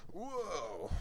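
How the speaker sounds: high, soft startled gasp of a word; retold fright at a blaring horn; startled whoa. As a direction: jump at a sudden horn. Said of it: "'cause it scared me".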